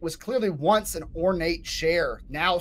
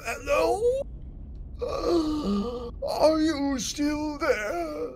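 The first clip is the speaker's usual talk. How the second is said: high, slow, moaning undead noble; zombie lord; 11 semitones higher, much slower than usual. Acted. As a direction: call out like a lost old zombie lord. GM voices zombie lord calling out.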